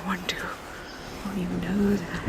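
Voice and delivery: soft yearning whisper; barely voiced, giving in. [name] confessing desire to Carlos, whispering.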